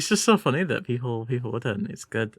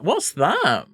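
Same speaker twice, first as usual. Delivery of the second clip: usual speaking voice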